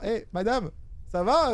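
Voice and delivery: ordinary delivery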